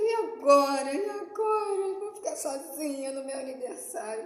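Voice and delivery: sobbing "what now" lament; crying despair. Retold or imitated. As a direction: cry out in retold panic. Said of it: "E eu chorei, chorei".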